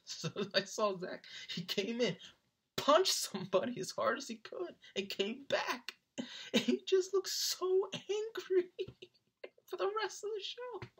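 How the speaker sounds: high voice, climbing as laughter takes over; laughing. Real, not acted. Retelling friend's angry mosh pit punch, cracking up. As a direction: lose it gradually until the words squeak.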